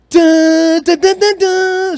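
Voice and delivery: ordinary delivery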